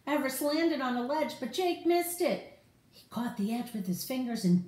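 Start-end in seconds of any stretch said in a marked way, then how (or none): none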